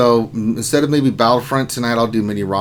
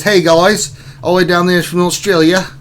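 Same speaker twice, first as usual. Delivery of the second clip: loud, higher, hammed-up Australian accent; bad Australian accent; 7 semitones higher, 5 dB louder than usual. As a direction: greet everyone like a badly faked Aussie. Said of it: "that's the worst Australian accent ever".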